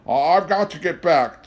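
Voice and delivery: high, loud urgent plea; desperate. A narrator's reading. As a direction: cry out in desperation. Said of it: "George said desperately".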